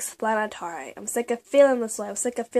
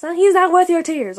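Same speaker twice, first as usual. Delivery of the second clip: much louder and higher, insistent; emphatic plea; 7 semitones higher, 11 dB louder than usual. Real, not acted. Urging viewers to leave someone who hurts them, loud and emphatic. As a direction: drive it home to them.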